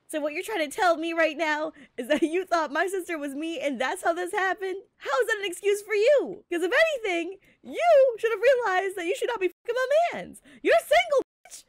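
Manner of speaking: put-on jealous girlfriend building to a shriek; building angry rant. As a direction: get angrier with every line until you're yelling. Woman confronting cheating boyfriend.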